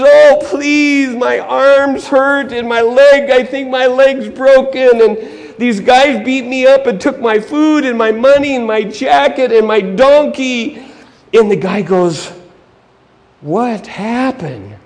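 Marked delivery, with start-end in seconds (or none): 0.0-10.8 high, loud, drawn-out begging; hammed-up wailing victim; pleading injured man. 13.4-14.8 very slow, drawn-out question; put-on pompous onlooker; questioning church leader. Church leader reacting to the victim.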